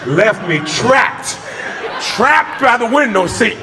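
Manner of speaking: high, slow, laughing cry of being stuck; laughing disbelief. Real, not acted. Stuck at the window seat as the man left, laughing high. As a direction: wail it through laughter.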